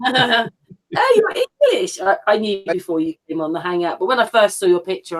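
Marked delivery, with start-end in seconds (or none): 0.1-2.0 much higher mock surprise on English; playful, mock-surprised oh, you're English. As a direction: act out a sudden realisation. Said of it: "but you didn't".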